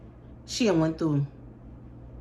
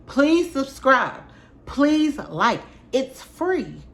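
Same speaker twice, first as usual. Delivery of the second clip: drawn-out plea; 9 semitones higher, 4 dB louder, much slower than usual. Real, not acted. Begging viewers to subscribe.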